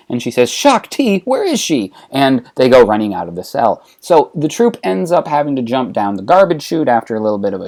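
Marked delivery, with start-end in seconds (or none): 0.5-1.9 startled excitement. Acted. Leia hearing Shaak Ti is aboard, high surprised excitement. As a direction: pitch shoots way up, eager and surprised.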